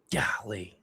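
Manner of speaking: soft, whispered exclamation; dismayed whisper. Real, not acted. Dismayed by a fumble.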